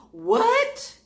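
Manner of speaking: high loud stunned cry; shocked what. Retold or imitated. Hearing about the meth lab, shocked high cry. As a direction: yelp it in disbelief.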